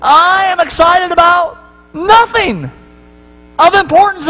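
high, fast-paced inner voice; excited self questioning. Retold or imitated. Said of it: "I'm thinking to myself".

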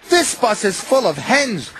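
complaining. A narrator's reading. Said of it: "they complained".

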